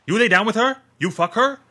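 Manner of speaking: high, loud questioning shout, You fuck her rising on her; suspicious demand. Acted. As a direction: interrogate him, voice climbing. Gunman questions Dan about the girl.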